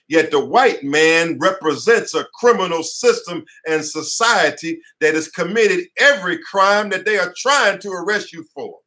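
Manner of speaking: usual speaking voice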